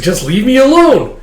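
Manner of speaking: high, loud mock plea; exasperated yell. Hero slashing at flies, exasperated mock yell. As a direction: shout the swarm away, hammed-up. Besieged hero in a dream fight.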